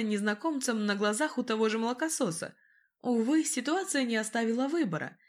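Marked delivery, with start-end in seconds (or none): none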